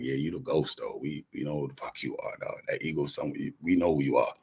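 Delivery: low, soft imitated aside; knowing undertone. Others telling him they know who he is, low knowing voice.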